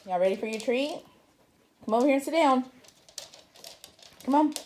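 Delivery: loud pet-calling voice; calling dogs. Real, not acted. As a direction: call the dogs over.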